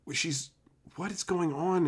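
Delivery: ordinary delivery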